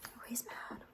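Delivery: breathy amused whisper. Real, not acted. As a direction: whisper it so the dog won't hear. Whispered about the dog.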